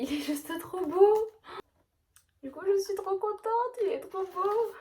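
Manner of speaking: high-pitched, drawn-out gushing; thrilled, voice lifted high; gushing delight. Real, not acted. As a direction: gush over something lovely. Gushing over new colouring book.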